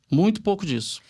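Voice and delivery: ordinary talk, nothing marked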